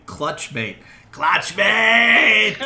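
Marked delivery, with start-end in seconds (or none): 1.1-2.6 full-throated, high lizard-creature shriek; screaming. Acted. Game master as lizard creature recognizing a friend, screaming. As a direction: scream it out loud, drawing out the last word. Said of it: "and it's screaming".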